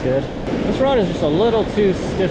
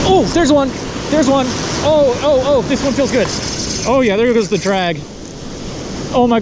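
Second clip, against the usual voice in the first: excited; 4 semitones higher, 3 dB louder than usual. Real, not acted. Fish on the line.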